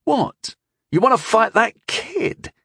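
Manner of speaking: high-pitched, disbelieving question; incredulous. A narrator's reading. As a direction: scoff at the very idea. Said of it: "snorting incredulously".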